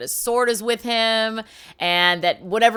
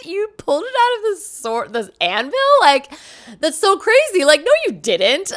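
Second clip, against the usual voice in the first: high, incredulous scoffing, getting louder; mocking disbelief; 8 semitones higher, 4 dB louder than usual. Tournament crowd laughs off Arthur's claim in loud, high disbelief. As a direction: scoff at the boy's unbelievable claim. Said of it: "everyone starts laughing".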